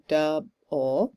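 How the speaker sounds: "duh" accented, then a separate, unaccented "ul"; syllable by syllable. Shown as a demonstration. Teacher shows another trochee on screen, syllable by syllable. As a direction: break "double" into "duh" and "ul". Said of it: "Again, accent, unaccent".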